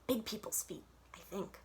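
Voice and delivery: scared little whisper from a hiding spot; hushed whisper. A narrator's reading. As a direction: whisper it from the closet. Hiding girl hears grown-ups nearby.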